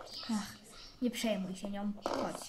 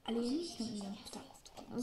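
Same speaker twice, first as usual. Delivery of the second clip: whisper; 4 semitones lower, 9 dB quieter than usual. Real, not acted. Child admitting quietly.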